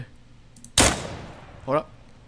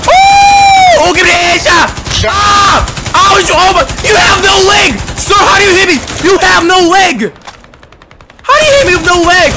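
high, loud disbelieving shouts; shocked yelling; 12 semitones higher, 8 dB louder than usual. Real, not acted. Gamer shot by a legless soldier, shocked yelling. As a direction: scream in disbelief at the legless guy.

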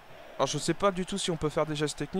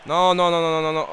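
panicked shout; 2 semitones higher, 9 dB louder than usual. Real, not acted. Gamer panicking as the ball nears his goal, loud panicked no. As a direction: yell it as the ball flies at your goal.

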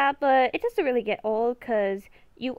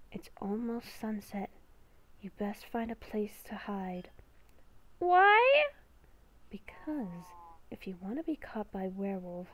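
beast within voice; 4 semitones lower, 8 dB quieter than usual. Acted. Inner beast whispering a warning to Haley, hushed and ominous. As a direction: whisper like a creature living inside her head.

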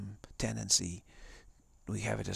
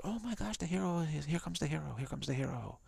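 much higher, thrilled gasp at the hero; excited crowd; 7 semitones higher than usual. Retold or imitated. Audience in the theater sees the hero arrive, excited high cry. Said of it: "suddenly everyone goes".

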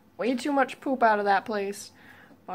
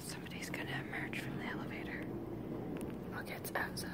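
quick hushed whisper; 15 dB quieter, much faster than usual. Real, not acted. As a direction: whisper fast, like spying. Hushed watching out window.

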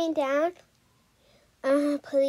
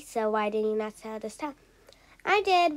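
ordinary delivery

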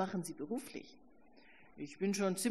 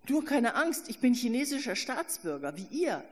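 much higher and louder, urgent; calling out reassurance; 6 semitones higher, 6 dB louder than usual. A narrator's reading. Narrator calls after fleeing students, loud and urgent. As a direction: call after them not to be afraid.

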